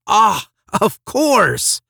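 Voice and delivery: high, loud, drawn-out exclamation; slow, ringing burst of discovery; sudden realisation. A narrator's reading. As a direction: cry out as the answer dawns.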